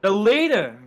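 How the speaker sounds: high, loud sudden shock; shocked cry. Real, not acted. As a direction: gasp it out. Leader caught in wreck.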